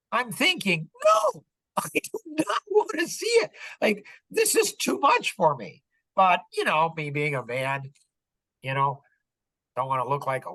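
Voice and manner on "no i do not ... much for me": very high-pitched, squeaky inner voice; comic high squeal of refusal; mock squeamish protest. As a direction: act out a reluctant "no way" in a high voice. Acts out his reluctant inner thought.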